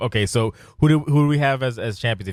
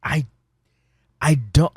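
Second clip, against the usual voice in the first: ordinary delivery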